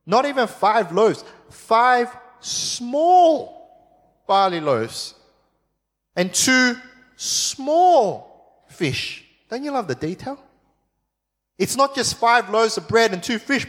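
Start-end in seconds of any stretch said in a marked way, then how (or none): 0.0-9.2 "small" stretched slowly and set apart; stress on small. Shown as a demonstration. Preacher to congregation, dwelling on "small". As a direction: linger on each "small" in "five small barley loaves". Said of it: "and listen to the wording".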